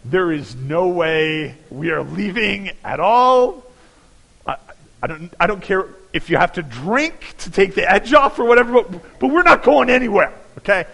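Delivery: mock-firm no to wife with sore feet; mock defiant refusal.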